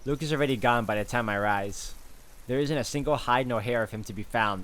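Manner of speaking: ordinary delivery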